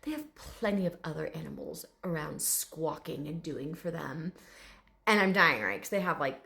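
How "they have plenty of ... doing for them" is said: softly voiced, slightly low dignified dragon; proper gentleman. Bearded dragon grumbling about other animals, mildly proper. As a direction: lightly play a refined little gentleman. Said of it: "this very proper gentleman".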